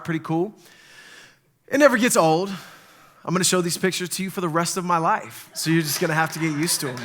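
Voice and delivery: ordinary delivery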